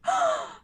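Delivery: high, short gasp; startled swooning gasp. Acted. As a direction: gasp as if suddenly touched. Acted gasp at being touched.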